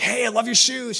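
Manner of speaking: bright, high, excited hello; eager greeting. Retold or imitated. Campus pastor greets a new girl, high and excited.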